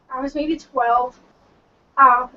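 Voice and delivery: ordinary talk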